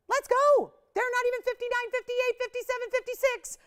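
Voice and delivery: high, loud, squealing price countdown; excited countdown. Real, not acted. Host to viewers dropping the price, squealing with excitement. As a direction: shriek the prices down like a game show win.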